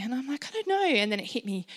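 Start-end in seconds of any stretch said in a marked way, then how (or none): 0.5-0.8 high, bewildered retold protest; a mild replay of panic, pitched high. Wife answering her husband mid-panic, retold high and bewildered. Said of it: "And I'm panicking".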